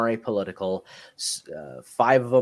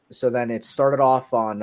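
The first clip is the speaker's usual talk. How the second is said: ordinary talk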